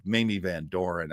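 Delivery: usual speaking voice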